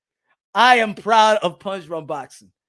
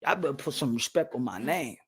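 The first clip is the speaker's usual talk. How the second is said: [name] impression; 10 semitones lower, 10 dB quieter than usual. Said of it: "I'm on that [name] shit".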